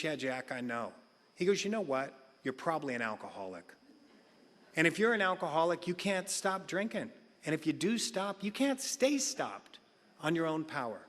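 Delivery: low, soft and kind; gentle. Retold or imitated. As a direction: say it gently and quietly. Sponsor's gentle words.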